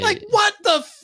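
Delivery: high squeaky what-the; mock outrage. Host squeaks mock outrage at a condescending celebrity.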